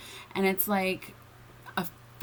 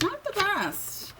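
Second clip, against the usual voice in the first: a lightly put-on British accent, a bit higher; self-mocking British accent; 12 semitones higher, slower than usual. Reader jokes about her own mock British accent. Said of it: "My British accent".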